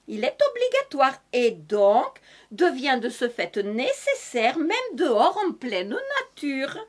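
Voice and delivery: lightly put-on southern French accent with its sing-song lilt; a touch of southern French accent, hammed up for comedy. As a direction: play a cheerful Madame Masque with a hint of southern French accent. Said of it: "je continue avec cet accent du Sud".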